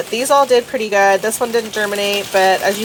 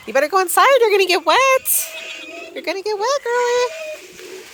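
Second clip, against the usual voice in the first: high pet voice. Acted. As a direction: coax her inside like a pet. Coaxing animal in from rain.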